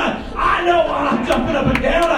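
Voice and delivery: loud, high, shouting sermon delivery; full-force preaching. Acted. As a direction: preach it hard to the congregation.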